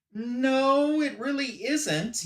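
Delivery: high, drawn-out "no" in retelling; mock-patient contradiction. Businessman contradicts the professor over his invention, drawn-out and condescending. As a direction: stretch it out high, as if correcting someone.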